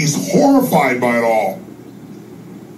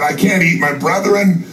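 horrified; 7 semitones higher than usual. Acted. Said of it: "He's horrified".

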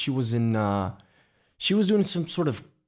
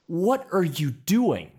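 much higher, pained reproach; upset scolding boss; 9 semitones higher than usual. Retold or imitated. Photographer scolds assistant for cutting his first book unboxing, high and upset. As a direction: let the hurt and anger spill over the lost moment. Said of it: "he's saying".

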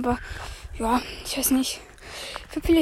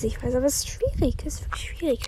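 whispering; 6 dB quieter, faster than usual. Real, not acted. Resigned aside to himself.

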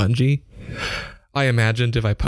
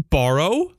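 outraged repeat; 5 semitones higher than usual. A narrator's reading.

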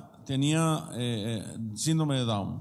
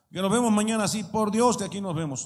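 higher and louder, swearing it by God; mock eager oath; 6 semitones higher, 4 dB louder than usual. Preacher mimics a careless oath by God. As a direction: overpromise with a hammed-up oath. Someone swearing they'll be there.

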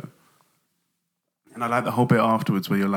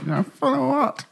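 put-on cheeky kid voice. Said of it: "When the little kid comes up".

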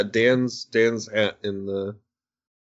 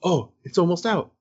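fibbing reassurance; 9 semitones higher than usual. Retold or imitated. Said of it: "lied to her and said".

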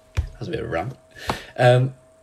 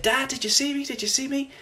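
very high, excited little turtle voice; excited kid. Retold or imitated. Little turtle telling his dad he did it, excited mock kid voice. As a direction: squeal it like a child showing off to dad. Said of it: "and he gets excited".

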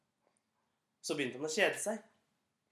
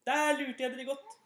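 gloating; 8 semitones higher, 3 dB louder than usual. Acted. Gutten ler av landsbyfolket.

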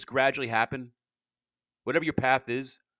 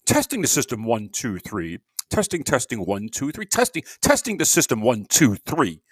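loud, sing-song mic check call; 7 dB louder than usual. Shown as a demonstration. Podcaster testing his mic, loud mic check. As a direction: announce it like testing the PA. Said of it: "Usually, usually I say".

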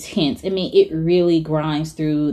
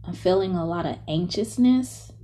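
softer, half-whispered breathing cues; guided meditation; 9 semitones higher, 12 dB quieter than usual. Acted.

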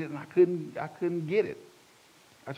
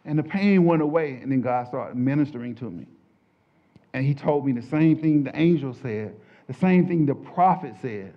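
ordinary talk

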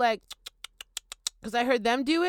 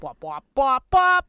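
1 crow call imitation; 3 semitones higher, 7 dB louder than usual. Shown as a demonstration. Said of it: "That's the sound of the crows".

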